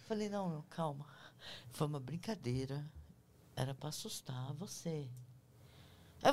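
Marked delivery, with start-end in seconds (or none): 0.3-5.1 reassuring. Retold or imitated. Calming the scared colleague.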